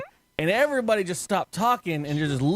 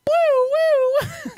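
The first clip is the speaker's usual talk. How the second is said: loud, high siren whoops; imitated siren; 5 dB louder than usual. Imitates siren when curfew comes.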